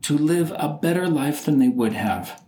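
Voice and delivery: measured, formal tribute with long pauses; grave, deliberate speech, weighing each phrase; solemn eulogy. Acted. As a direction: deliver a funeral tribute phrase by phrase. Recites his own wished-for eulogy.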